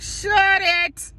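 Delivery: high, strained "zip it" tone; urgent hushing. Retold or imitated. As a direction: imitate desperately silencing your sister. Retold hushing of tactless sister.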